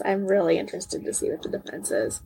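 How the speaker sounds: low and soft, trailing off; quiet afterthought. Real, not acted. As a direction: drop the voice, as if musing. Softer repeat adding really.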